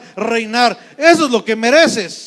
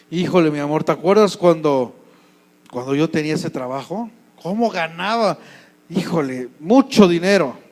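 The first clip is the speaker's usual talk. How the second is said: low, softer musing to a wife; wistful reminiscing; 5 semitones lower, 3 dB quieter than usual. Acted. Husband recalling his old job to his wife, wistful. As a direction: reminisce fondly, lowered voice.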